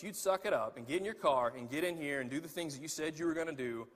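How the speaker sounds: normal talking voice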